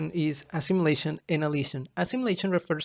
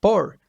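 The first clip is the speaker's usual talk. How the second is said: "por" hit louder; stressed syllable; 3 semitones higher, 7 dB louder than usual. Shown as a demonstration. Teacher showing where purple is stressed, "por" punched loud. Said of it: "the stress is, is in the first syllable".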